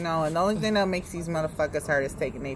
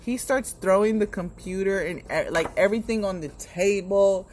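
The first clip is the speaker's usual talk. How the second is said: usual speaking voice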